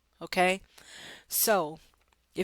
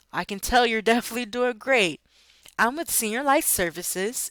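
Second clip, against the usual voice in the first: higher, louder, laughing sales opener; excited pitch; 4 semitones higher, 4 dB louder than usual. Shown as a demonstration. Sales agent introducing the company to a cheerful client. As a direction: keep the happy energy going into the pitch. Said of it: "you wanna be excited".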